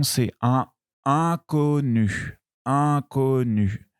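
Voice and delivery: c'est un inconnu slow with un linked to inconnu; liaison shown. Shown as a demonstration. Teacher showing the liaison, slow. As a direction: link un into inconnu slowly. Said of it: "je fais la liaison".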